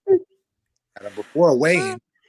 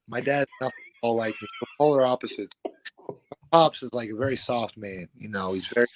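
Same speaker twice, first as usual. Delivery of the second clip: normal talking voice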